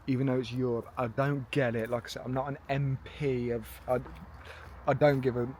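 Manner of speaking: ordinary delivery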